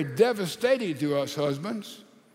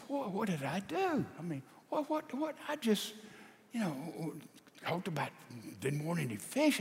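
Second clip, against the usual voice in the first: soft, halting stammer; bewildered self-defence; 8 dB quieter than usual. Acted. Husband wonders why his wife cried, bewildered self-defence. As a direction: fumble quietly for an excuse, baffled.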